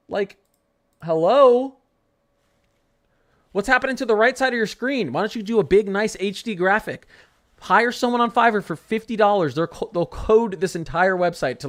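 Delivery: high, loud incredulous ranting; outraged at the website; shrill disbelief rant; heated rant. Real, not acted. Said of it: "Stop getting me heated".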